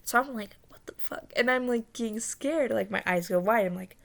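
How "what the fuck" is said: hushed, voiceless disbelief; retold shocked whisper. Dreamer hiding near two bodies, whispering in shock.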